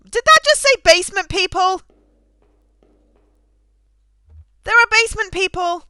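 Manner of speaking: loud, high-pitched disbelief; real astonishment. Real, not acted. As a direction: exclaim it, thrilled and incredulous. Startled by what the game says.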